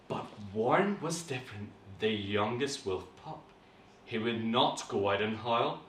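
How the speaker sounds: soft, low suspenseful storytelling; hushed, measured reading aloud; story narration. Acted. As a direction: draw children into the tale with pauses.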